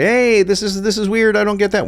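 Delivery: high-pitched mock complaint; puzzled viewer. Viewer objecting to what they see on the page, high mock confusion. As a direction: voice a baffled customer, pitched well up.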